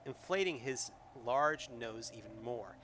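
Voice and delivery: usual speaking voice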